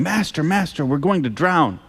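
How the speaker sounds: narrated cry for help, understated; mild shout. A narrator's reading. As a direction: read a shouted plea without raising the volume.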